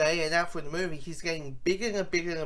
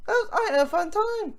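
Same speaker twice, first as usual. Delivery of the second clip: delighted; 4 dB louder than usual. Retold or imitated. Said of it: "I'm like".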